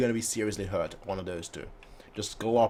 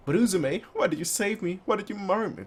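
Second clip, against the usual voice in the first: pleading; 8 semitones higher than usual. Acted. Character pleading in read dialogue.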